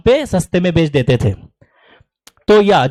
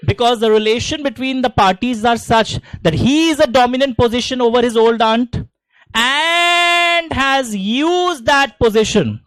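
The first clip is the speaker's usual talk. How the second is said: slow, deliberate model answer; teacher slowly dictating the exam answer; slow dictation; 2 semitones higher, much slower than usual. Shown as a demonstration. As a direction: drag each word out for students to write down. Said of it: "dictates the model answer".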